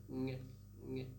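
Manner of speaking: NG as one velar nasal sound; demonstrated ng sound. Shown as a demonstration. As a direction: hum NG at the back of the mouth, no separate G. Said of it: "It's like this".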